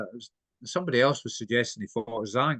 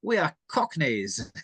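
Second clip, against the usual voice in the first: posh mock Cockney; 3 semitones higher, slower than usual. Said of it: "the posh people going".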